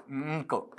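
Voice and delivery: ंक as a hummed nasal, then a released k; sound broken down. Shown as a demonstration. Teacher breaking a word into sounds, demonstrated ंक. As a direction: hum the ng softly, then release the k in ंक. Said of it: "ऐसा".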